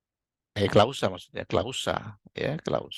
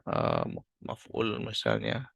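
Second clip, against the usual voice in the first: ordinary delivery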